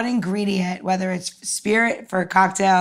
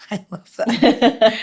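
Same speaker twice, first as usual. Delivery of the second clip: laughing warmly, slower; delighted chuckle through the words; 2 semitones higher, slower than usual. Real, not acted. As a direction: laugh it out fondly. Laughing after the wine answer.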